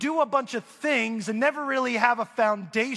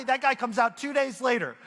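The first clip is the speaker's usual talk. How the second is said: ordinary talk, nothing marked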